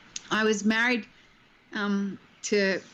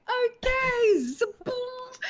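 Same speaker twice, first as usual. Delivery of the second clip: mock terrified whimper; much slower than usual. Young actress after Hopkins roars at her, mock tearful whimper. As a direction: squeak it out like you're about to cry. Her shocked reaction, crying voice.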